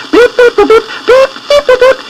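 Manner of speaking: tiny high peeping chicks; chick voices. A narrator's reading. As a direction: squeak it like little chicks. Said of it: "every little fluffy chicken said".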